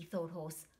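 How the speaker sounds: plain reading voice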